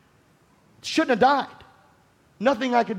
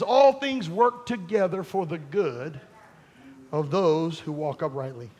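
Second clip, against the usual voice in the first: slow quote; 4 semitones lower, slower than usual. Acted. Quoting an old saying slowly.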